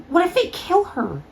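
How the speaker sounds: high, alarmed fear; panicked worry. Acted. Friend fears for captured Twilight, panicked. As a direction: voice jumps up in panic.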